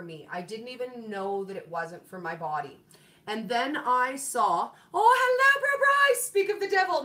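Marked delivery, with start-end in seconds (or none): 4.9-7.0 high, loud, delighted hello; light up as a friend appears; squealing hello to a favourite viewer; excited greeting. Real, not acted. Greets viewer Bryce in live chat.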